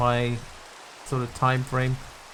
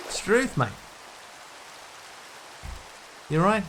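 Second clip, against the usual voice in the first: mock Australian slang, higher and bouncy; Australian accent; 5 semitones higher, 6 dB quieter than usual. Streamer keeps joking to chat in a hammed-up Australian accent. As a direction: lay the Aussie accent on thick. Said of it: "authentic Australian phrases".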